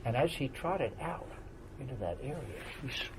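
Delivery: usual speaking voice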